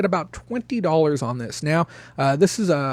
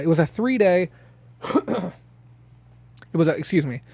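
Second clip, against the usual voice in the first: winded after the swap meet; out of breath; 3 semitones higher than usual. Real, not acted. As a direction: talk while catching your breath. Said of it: "still catching my breath".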